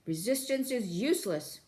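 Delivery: robotic guard bark; guard shouting. Acted. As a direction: bark the order like a bored guard. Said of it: "the guard shouting again".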